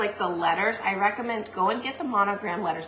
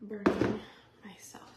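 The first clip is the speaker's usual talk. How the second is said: quiet unvoiced whisper while focusing; 9 dB quieter than usual. Real, not acted. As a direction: breathe the words out, concentrating on the task. Concentrating while gluing hot piece.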